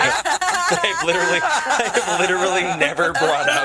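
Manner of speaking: helpless real laughter through the words; high-pitched, barely talking through laughter; laughing hard. Real, not acted. Player cracks up about the trinket.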